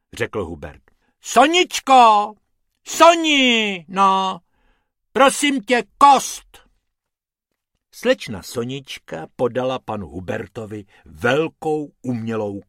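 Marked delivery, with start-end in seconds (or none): 1.2-6.6 high, loud call to his assistant; demanding the bone; pompous hippo calling. Acted. Hubert calls Sonička for the bone, loud.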